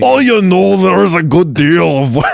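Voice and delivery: high, loud mock Scottish burr. Mocking the cohost's accent, high and silly Scottish. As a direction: tease your friend with a silly Scottish voice.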